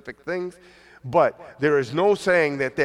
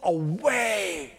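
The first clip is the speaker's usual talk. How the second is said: high, slow drawn-out demon cry; eerie drawn-out go away; demon screaming; 7 semitones higher, much slower than usual. Retold or imitated. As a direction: stretch it out like a possessed man crying out. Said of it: "they scream out".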